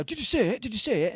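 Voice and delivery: high-pitched imitation of a stoked beginner; eager, high retold excitement; excited beginner. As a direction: mimic someone bursting to be told yes.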